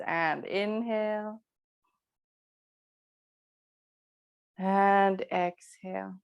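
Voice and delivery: slow, stretched inhale and exhale cues; drawn-out breath cue. Real, not acted. Yoga teacher timing the class's breathing, slow and drawn out. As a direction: pace each word to a long breath.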